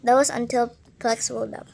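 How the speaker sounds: usual speaking voice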